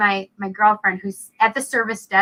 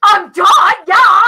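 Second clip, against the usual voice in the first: a loud, excited yes shouted high; 8 semitones higher, 10 dB louder, slower than usual. Retold or imitated. Accepting co-host, shouted.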